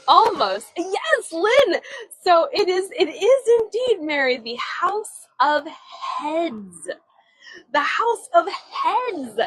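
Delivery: high, loud and delighted; excited reveal. Real, not acted. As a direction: cheer the right guess, then announce it big. Cheering viewers' correct guess, revealing answer.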